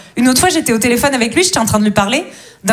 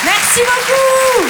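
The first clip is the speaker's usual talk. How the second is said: drawn-out thanks; 12 semitones higher, much slower than usual. Real, not acted. Thanking the crowd at the end.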